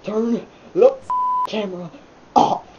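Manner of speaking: words jolted by loud hiccups. Real, not acted. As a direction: hiccup mid-sentence. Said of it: "before these hiccups completely take over".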